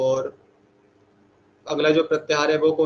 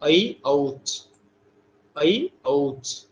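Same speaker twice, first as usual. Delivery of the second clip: ऐ and औच drawn out long; very slow teaching chant; slow recitation; much slower than usual. Shown as a demonstration. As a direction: chant it slowly for students to repeat. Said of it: "चौथा प्रत्याहार कौन सा है?".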